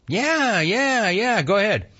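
high-pitched, drawn-out yeahs; high mock-dude voice; retold player's reply. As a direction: mimic a player waving him in, pitched well up.

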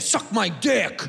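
slow, hostile delivery; negative. Shown as a demonstration. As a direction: spit it as an insult, drawn out. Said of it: "We say it negative".

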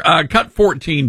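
normal talking voice

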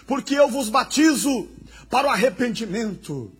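preacher voicing a crying prophet; John the Baptist preaching. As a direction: call the crowd to repent in ringing phrases. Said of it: "Ele dizia".

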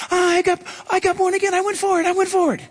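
high, eager convert voice; excited new convert. Retold or imitated. Preacher voicing a giddy new convert to the congregation, high and eager. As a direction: chirp it like a thrilled new believer.

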